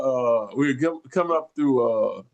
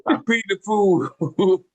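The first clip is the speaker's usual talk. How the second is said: Mister T; 7 semitones higher than usual. Retold or imitated. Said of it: "make that guy sound like Mister T".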